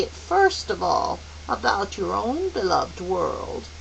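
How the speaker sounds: put-on kindly old mentor to a child; gentle elder guide. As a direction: explain tenderly and unhurriedly.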